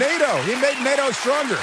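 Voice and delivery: high, loud indignant retort; high loud sarcastic shout; sarcastic outburst. Acted. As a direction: fire back at him mockingly.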